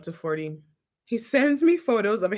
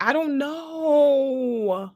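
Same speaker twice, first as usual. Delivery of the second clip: mock crying; 8 semitones higher, much slower than usual. Exasperated about his over-the-top message.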